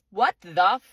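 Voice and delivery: loud, drawn-out exasperation; slow, loud, fed-up outburst; angry. Real, not acted. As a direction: stretch each word out in real anger. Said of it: "more fucking mad I'm gonna get".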